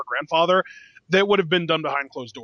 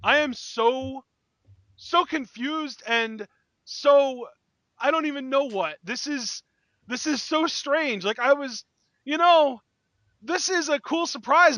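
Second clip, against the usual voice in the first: amazed; 7 semitones higher, slower than usual. Real, not acted. Stunned by Shane's return.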